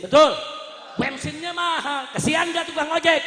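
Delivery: high, loud rhetorical questions; rallying the crowd. Real, not acted. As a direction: shout for the crowd's agreement. (bertanya lantang kepada massa)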